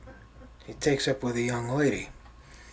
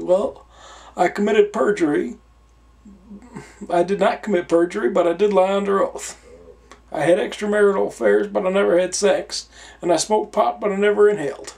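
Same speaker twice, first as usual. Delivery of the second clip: higher, louder put-on Southern politician voice; Clinton impression; 7 semitones higher, 5 dB louder than usual. Clinton presenting himself to Saint Peter in a put-on voice. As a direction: announce yourself like a grand ex-president.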